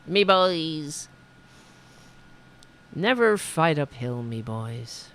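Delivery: fading, dropping brogue at the end; mock Irish. Host repeating the Irish Lee quote, fading mock brogue. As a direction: trail off in a faint Irish lilt.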